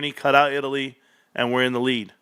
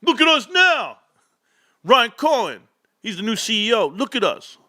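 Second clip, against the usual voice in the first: mock chest-beating brag; 8 semitones higher than usual. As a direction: ham up a crowing fan. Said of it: "We're out here beating our chest".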